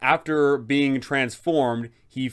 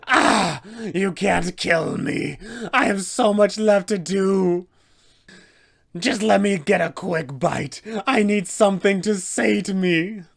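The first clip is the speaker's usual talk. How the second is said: high, louder desperate monster cries; screaming vampire; 8 semitones higher, 4 dB louder than usual. Acted. Cornered vampire screams at the party attacking him, desperate monster cries. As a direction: fight for your life and your meal. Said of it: "he will go ahead and scream out".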